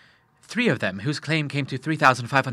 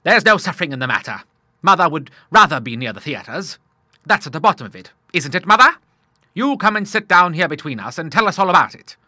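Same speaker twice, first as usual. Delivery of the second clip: lightly voiced hearty old seaman, louder; a touch of a jolly old sailor cheering up his son; father reassures his troubled son, lightly gruff; bluff old Admiral; 5 semitones higher, 7 dB louder than usual. A narrator's reading.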